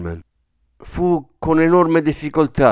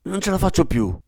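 higher-pitched strained hoarse plea; hoarse exclamation; 7 semitones higher than usual. A narrator's reading. Father begging the nurse to hurry, hoarse. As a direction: plead at the end of endurance. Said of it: "esclamò con voce rauca".

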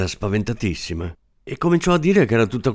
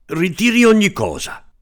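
high, pressing demand; a small man trying to threaten; menacing; 10 semitones higher, 4 dB louder than usual. Acted. Little man demanding the assistant take it back, mock menacing. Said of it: "disse minaccioso".

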